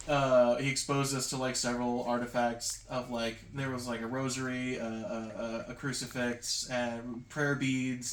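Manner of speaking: usual speaking voice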